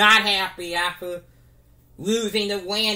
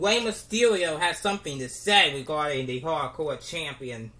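ordinary talk, nothing marked

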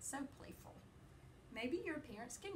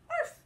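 high, loud little arf; puppy yip; 14 dB louder than usual. Acted. Teacher voicing the little pup for children, loud squeaky yip.

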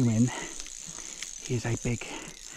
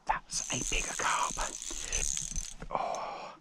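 awed whisper; 10 dB quieter, slower than usual. Real, not acted. Awed at a bigger carp.

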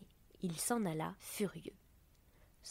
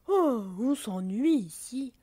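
drawn-out, louder, higher yawn of boredom; 4 semitones higher, 7 dB louder, slower than usual. A narrator's reading. Bored water drop rising from her cloud, bored and yawning. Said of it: "se leva en bâillant".

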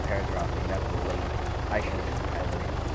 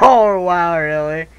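excited; 8 semitones higher, 15 dB louder, much slower than usual. Real, not acted. Excited reaction.

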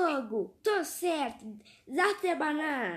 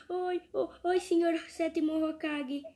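ordinary talk, nothing marked

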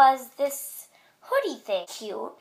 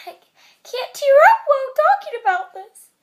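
hammed-up crying over school; mock crying; 11 semitones higher, 7 dB louder, faster than usual. Teen vlogger fake-sobbing to viewers about school. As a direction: fake tearful wail, high and loud. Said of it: "Just kidding".

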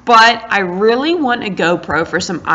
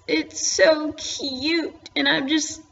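high, drawn-out cooing; smitten gushing; 8 semitones higher, 4 dB quieter, slower than usual. Real, not acted. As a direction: draw it out high, besotted with it. Gushing over a longed-for pet.

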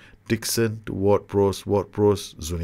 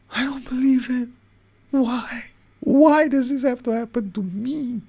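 much higher and louder, wailing in disbelief; despairing outcry; 6 dB louder than usual. Real, not acted. Streamer reeling from a horrific conceded goal, real high wailing outcry. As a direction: rant to the heavens at your own bad luck.

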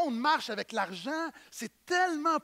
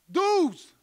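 loud, higher, blurted out; eager answer; 8 semitones higher, 8 dB louder than usual. Acted. Disciples answering Jesus eagerly, loud and proud. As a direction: shout the answer like a keen pupil.

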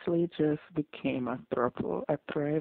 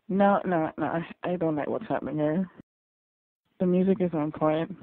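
normal talking voice

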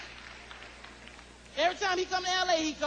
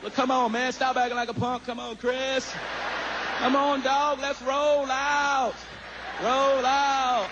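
Bill Clinton; 6 semitones lower, slower than usual. Retold or imitated. Ex-president calling Chris to hang out, in a low booming matey voice. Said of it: "He be like".

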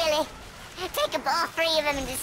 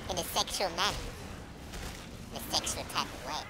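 low suggestive aside; 10 semitones lower, 7 dB quieter than usual. Acted.

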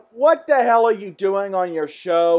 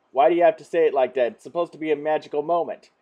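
ordinary delivery

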